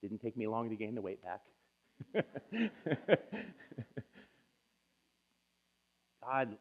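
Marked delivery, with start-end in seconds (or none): 2.0-4.0 laugh. Real, not acted. Rueful laugh mid-testimony.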